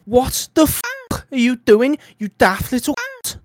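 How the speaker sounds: loud, high Scouse driver's rant; acted Scouse road rage; Scouse accent. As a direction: tear into someone in hammed-up Scouse. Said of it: "rendition of him getting out of the car".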